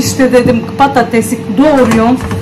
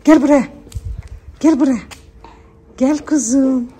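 higher coaxing call to the dog; 5 semitones higher than usual. Real, not acted. Calling the crying dog over, a higher coaxing call. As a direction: coax her over sweetly.

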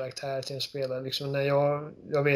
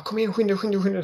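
excited urging; 5 semitones higher, 4 dB louder, faster than usual. Retold or imitated. Ellie urging Joel, retold excitedly.

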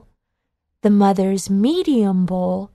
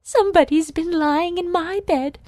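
higher, prim mother bear voice; much higher voice, put out and fussy; mother bear sniffing; 8 semitones higher than usual. Acted. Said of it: "sniffed the mother bear".

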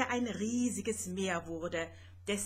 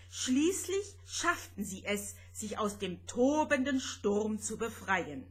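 plain reading voice

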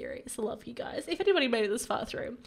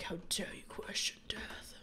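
hushed, whispery rebuke from Death; offended Death; 3 semitones lower, 10 dB quieter than usual. Acted. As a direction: whisper it as an affronted Reaper. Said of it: "He's like".

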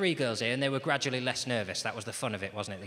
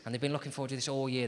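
ordinary delivery

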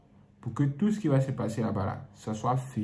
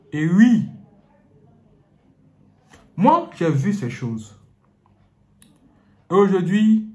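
preaching; 6 semitones higher, 9 dB louder, slower than usual. Acted. Preaching at full force.

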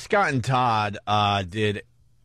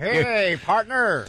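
high, hammed-up cowboy greeting; 10 semitones higher, much slower than usual. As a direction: drawl it like an old prospector. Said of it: "working in a ghost town out west".

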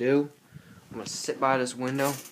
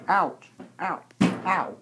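mock pained yelps; 8 semitones higher, 7 dB louder, much slower than usual. Hurt by brother.

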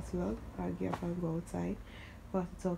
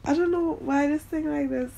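laughing; 8 semitones higher, 7 dB louder than usual. Real, not acted. Laughing about vlogging with new gadget.

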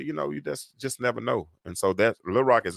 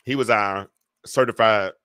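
ordinary talk

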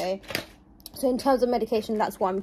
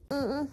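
pained hum; 5 semitones higher, 3 dB quieter than usual. Real, not acted. In pain after surgery.

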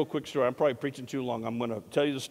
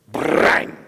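loud, noisy zap sound effect; imitated lightning strike; 13 dB louder than usual. Preacher pretending to strike all twelve, explosive zap. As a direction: let the bolt crack out in one burst.

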